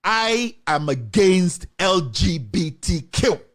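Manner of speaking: slow, deliberate, every letter spelled out; emphatic statement. Pretend. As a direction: declare it word by word like an official stance. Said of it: "you should have released an emphatic statement".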